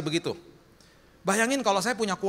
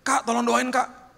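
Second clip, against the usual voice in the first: put-on pleading young man; 7 semitones higher, 3 dB louder, slower than usual. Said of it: "Anak muda datang kepada saya".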